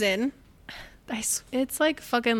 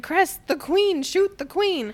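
high, urgent mock yell; imitated yell; 9 semitones higher, 5 dB louder, slower than usual. Cinder ordering Cress to shoot, imitated yell. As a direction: call out an order as if yelling across a room. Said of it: "So Cinder yells".

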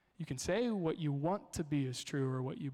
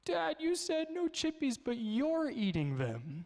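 put-on much higher, indignant little-girl voice; accusing toddler; 10 semitones higher, slower than usual. Daughter catches dad eating chips, indignant. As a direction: catch dad out like a three-year-old. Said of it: "my daughter going".

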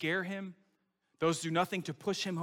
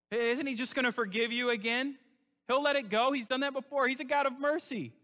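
higher and louder, mock-sweet persuasion; Satan selling easy grace, higher and louder; tempting Satan; 9 semitones higher, 4 dB louder than usual. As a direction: sell the lie with an eager lift. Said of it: "Satan says".

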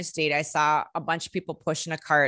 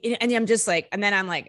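usual speaking voice

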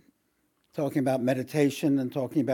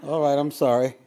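laughing; 4 dB louder than usual. Real, not acted. Laughing after the clip.

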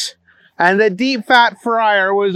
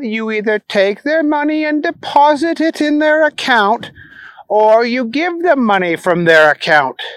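a hint of a patient prim manager; lightly voiced manager; 7 semitones higher than usual. Asquith voiced.

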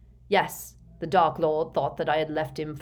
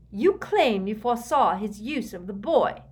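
high, mocking accusation; scornful, higher-pitched jeer; 6 semitones higher, 5 dB louder than usual. A narrator's reading. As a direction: taunt with open derision. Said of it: "she jeered".

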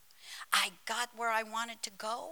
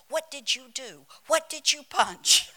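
raised voice, building louder; mock accusing questions; 3 semitones higher, 8 dB louder than usual. Associate pastor asking pastor what she punched, mock exasperation building louder. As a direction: imitate an exasperated helper demanding answers.

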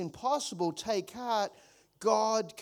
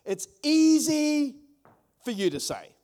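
sarcastic retort; 4 semitones higher, 4 dB louder than usual. Shown as a demonstration. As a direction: drag out easy with scorn. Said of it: "I'll put it into my words".

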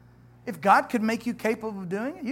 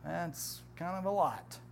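doubtful listener; 4 semitones lower, 11 dB quieter, slower than usual. Retold or imitated. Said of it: "Some of you are going".

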